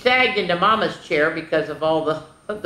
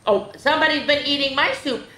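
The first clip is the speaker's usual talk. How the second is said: mild shriek; 9 semitones higher than usual. Acted. Mama finding her soup eaten, mild shriek. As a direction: raise the pitch for a shocked Mama. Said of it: "Mama shrieked".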